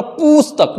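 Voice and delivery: पुस्तक said wrongly as poos-tak; long u. Shown as a demonstration. Teacher rejects this form, पुस्तक with long oo. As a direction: hold the first vowel of पुस्तक as oo. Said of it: "ये गलत है".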